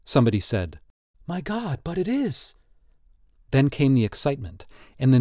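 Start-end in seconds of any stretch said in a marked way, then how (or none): 1.3-2.5 high, soft gasp of recognition; hushed amazement. A narrator's reading. Onlooker recognising the tattooed picture, softly amazed. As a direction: lift the pitch, keep it quiet.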